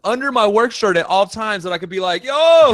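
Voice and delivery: high, loud and excited. Pretend. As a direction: pitch it up, loud and eager. Acting out his choking shirt.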